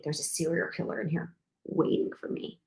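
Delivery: low, soft dread; fearful hushed thought. Retold or imitated. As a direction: murmur it like you fear being heard. Scared thought in quiet bathroom.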